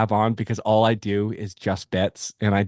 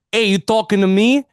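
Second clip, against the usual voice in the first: put-on tough-guy New York accent; 9 semitones higher, 5 dB louder than usual. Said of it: "Do a stereotypical New York accent".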